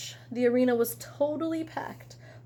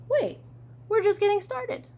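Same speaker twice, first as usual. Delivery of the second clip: high, eager protest; 10 semitones higher than usual. Acted. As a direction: refuse to let the story end. Character protests the ending.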